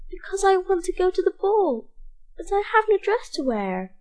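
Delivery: put-on high, pleading young girl voice; Cinderella. Cinderella asks godmother.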